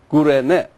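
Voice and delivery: Kurene said koo-reh-NEH; Y pronounced U. Shown as a demonstration. Teacher showing that Y pronounces U, demonstrated pronunciation. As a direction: give Kurene an oo vowel where Y is spelt. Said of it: "but it pronounces U".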